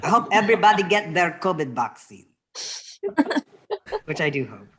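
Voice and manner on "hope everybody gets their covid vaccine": put-on louder Filipino accent, "kobid baksin" with b for v; playful Filipino accent, "get" for "gets". Joking to the audience in a Filipino accent. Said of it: "I do really like speaking in, um, a Filipino accent".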